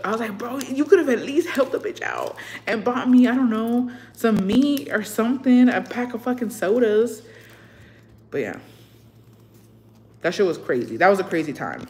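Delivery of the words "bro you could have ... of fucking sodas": higher, exasperated scolding; angry outburst. Retold or imitated. Daughter scolding her mom about the food card, exasperated. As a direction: scold mom in a raised, disbelieving pitch. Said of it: "I was so mad".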